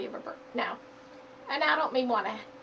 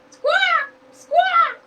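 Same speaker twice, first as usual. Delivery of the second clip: very high, loud bird squawk; squawking bird yell; 15 dB louder than usual. Acted. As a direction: screech like a falling chick. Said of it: "yelled the small bird".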